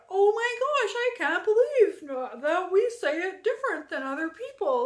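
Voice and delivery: high, loud mock amazement; mocking gasp. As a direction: parody someone overexcited. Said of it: "it's not like".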